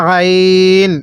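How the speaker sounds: আইন squeezed lightly in the throat, long held ee; drawn-out throaty আইন; light throat pressure. Shown as a demonstration. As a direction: stretch আইন from the throat on a level note. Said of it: "গলার মধ্যে হালকা চাপ দিয়ে পড়ব".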